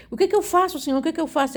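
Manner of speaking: high-pitched, reenacted appeal; helpless plea. Reenacting helpless question to God. (apelo aflito e agudo, encenado)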